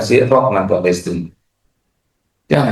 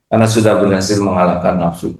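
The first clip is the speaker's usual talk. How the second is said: ordinary delivery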